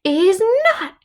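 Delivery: very high, loud tantrum scream; yelling at the top of her voice. Acted. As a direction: scream it like a furious spoiled child. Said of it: "yelled the princess at the top of her voice".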